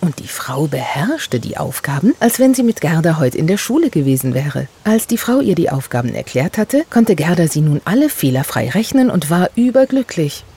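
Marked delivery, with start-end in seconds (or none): none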